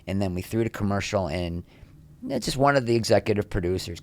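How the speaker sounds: usual speaking voice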